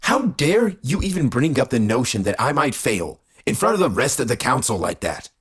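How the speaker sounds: offended and confrontational; indignant anger. A narrator's reading. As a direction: snap it out as an outraged challenge. Melthios confronts Morpheus in anger.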